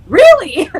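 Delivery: excited high reply. Retold or imitated. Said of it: "I'm like".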